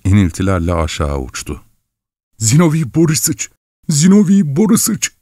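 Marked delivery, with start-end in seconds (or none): 2.4-3.5 high panicked calling of the name; terrified cry. A narrator's reading. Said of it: "diye mırıldanıyordu". 3.9-5.1 Zinovy shrieked even higher and louder; terrified cry. A narrator's reading. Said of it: "diye mırıldanıyordu".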